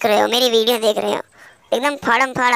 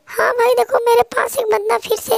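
much higher pleading voice calling a friend for backup; panicky teammate; 12 semitones higher than usual. Acted. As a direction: squeal for help like a scared teammate in a firefight. Teammate warns of enemy.

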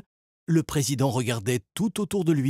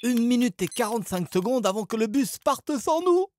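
panicked; 12 semitones higher, 4 dB louder than usual. A narrator's reading. Paniqua Salim.

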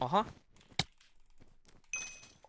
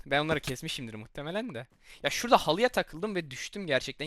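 ordinary talk, nothing marked

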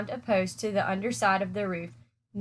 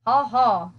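hearty guffaw; 6 dB louder than usual. A narrator's reading. Pig laughs at own joke.

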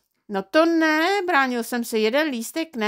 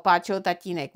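plain reading voice

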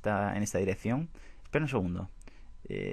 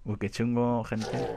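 ordinary talk

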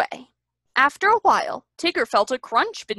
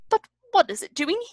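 high-pitched grumpy question; 9 semitones higher than usual. A narrator's reading. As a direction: ask it with huffy disapproval. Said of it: "a grumpy owl asked".

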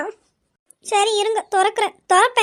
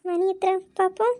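quiet aside; 6 dB quieter than usual. Acted. Sleepy daughter muttering to herself.

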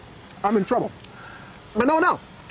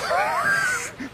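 high burst of laughter; 3 dB quieter than usual. Real, not acted. Laughing at teasing.

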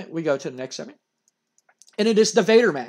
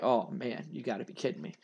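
much softer and lower, deflated; dismayed groan; 7 semitones lower, 12 dB quieter than usual. Retold or imitated. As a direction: sink into it like bad news just landed. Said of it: "And Owen's just like".

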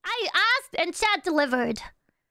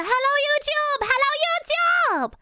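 high, bright call to chat; excited greeting; 7 semitones higher than usual. Shown as a demonstration. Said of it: "Everyone! All together now!".

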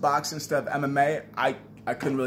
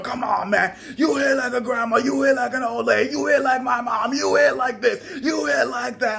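taunting shout; 8 semitones higher than usual. Retold or imitated. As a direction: yell taunts at his sparring partner. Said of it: "So I'm like".

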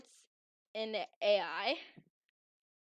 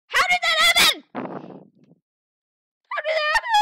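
very high loud disbelieving shout; shocked shout; 14 dB louder, slower than usual. Real, not acted. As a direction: yell in total disbelief, the second drawn out. Shocked by two Cookies.

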